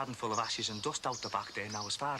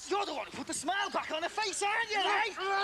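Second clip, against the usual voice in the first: incredulous fury. Acted. Husband rounding on the friend who bought presents, high furious disbelief. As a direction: spit it back at him in disbelief.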